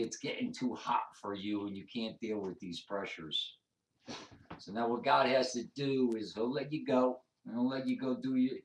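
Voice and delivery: ordinary talk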